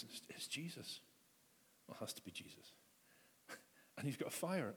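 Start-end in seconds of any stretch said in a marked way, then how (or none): 0.0-2.7 whispered, soft in wonder; soft whispered recognition; awed whisper. Acted. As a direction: breathe it out in awe. Peter recognises Jesus, awed.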